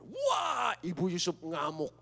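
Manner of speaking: excited cry. Real, not acted. Wife's rage announced.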